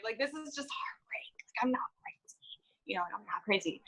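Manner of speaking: hushed, pleading whisper; desperate whisper. Retold or imitated. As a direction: whisper it urgently to convince them. Pleading with staff.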